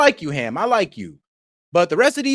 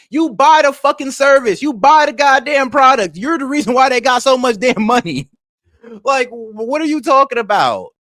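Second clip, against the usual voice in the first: loud, higher-pitched exasperation; raised voice, fed up and accusing; heated rebuke; 4 semitones higher, 5 dB louder than usual. Real, not acted. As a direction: hit each accusation hard and loud. Heated argument over buying products.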